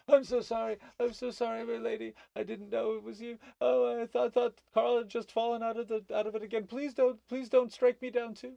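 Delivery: very high, loud frantic pleading; grovelling gnome. Acted. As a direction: beg for mercy as a panicked little gnome. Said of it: "completely prostrate, prostrating himself".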